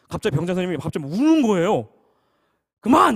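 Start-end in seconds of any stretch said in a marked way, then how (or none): 2.8-3.2 high, loud retold shout; sudden shouted order. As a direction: cut someone off with an acted yell. Senior abruptly stops the singing. (버럭 외치는 흉내)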